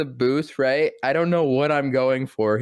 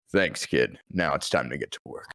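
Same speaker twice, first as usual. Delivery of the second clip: low, quiet, gruff and quick; calm Dark Knight rumble; Batman voice; 10 semitones lower, 5 dB quieter than usual. Retold or imitated. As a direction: growl it softly like Batman. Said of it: "he just kinda".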